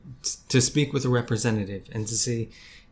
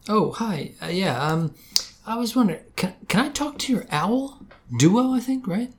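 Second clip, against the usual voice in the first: phone voice; 7 semitones higher than usual. Acted. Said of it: "I recorded the call".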